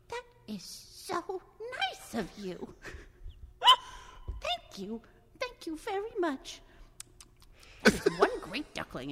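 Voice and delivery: high, slow, gushing pigeon thanks; grateful pigeon. Acted. Pigeon thanks duckling, high and slow. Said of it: "the pigeon says".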